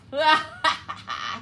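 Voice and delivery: loud, triumphant villain laugh. A narrator's reading. Fox laughing in triumph as he hurls the acorn. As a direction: laugh in victory. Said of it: "With a triumphant laugh".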